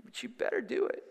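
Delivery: very soft, grudging acceptance; retold upset. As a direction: act out a tearful little surrender, quietly. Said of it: "I'm all upset".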